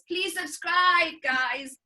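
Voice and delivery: high, loud, drawn-out mock plea; hammed-up YouTuber sign-off; pleading YouTuber. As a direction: beg the viewers, stretching it out.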